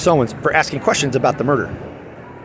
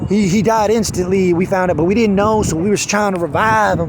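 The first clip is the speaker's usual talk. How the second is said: raised-pitch mocking imitation; mock fake-tearful; 5 semitones higher than usual. Pastor claims he died instantly, mock fake-tearful retelling. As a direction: mimic an unconvincing emotional account, pitched high. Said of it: "he does, like, some fake half tear".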